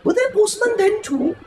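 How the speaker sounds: put-on high, curious child's question; a child breaking in, wide-eyed; little boy questioning the old storyteller; small boy asking.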